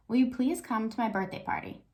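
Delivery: ordinary talk, nothing marked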